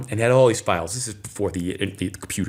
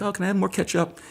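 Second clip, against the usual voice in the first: put-on little boy with a lisp; 8 semitones higher, faster than usual. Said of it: "He has a little lisp".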